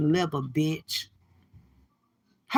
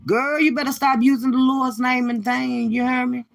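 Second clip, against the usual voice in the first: ordinary delivery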